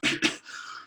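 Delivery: a short dry demo cough; quick throat-clearing cough. Shown as a demonstration. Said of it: "I'd cough".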